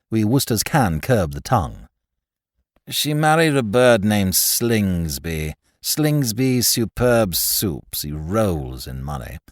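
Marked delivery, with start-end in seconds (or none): 2.9-9.4 lazily superior, lowered voice; Pym, smug invalid. Acted.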